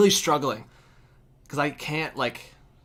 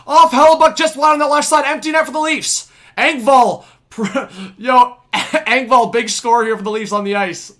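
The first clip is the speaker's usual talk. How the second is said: raised, loud live call; excited play-by-play; 10 semitones higher, 12 dB louder than usual. Real, not acted. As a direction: keep calling the play hot.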